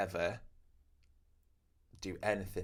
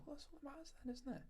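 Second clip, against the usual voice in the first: high and much softer; really soft; 16 dB quieter, faster than usual. Shown as a demonstration. Tattooist demonstrating her soft reply, high and soft. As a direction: float it up softly. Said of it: "And they go".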